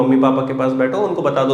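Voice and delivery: ordinary talk, nothing marked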